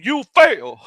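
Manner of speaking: "You fail" punched high and loud, mimicking a teacher; teacher impression, both words of "You fail" hammered; imitated heavy emphasis on "You fail". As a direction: deliver "You fail" as a mock emphatic verdict. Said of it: "he said it with such emphasis".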